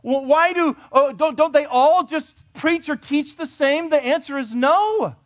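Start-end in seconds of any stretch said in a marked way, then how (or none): 0.0-3.9 voiced doubter. Voicing a doubter's question.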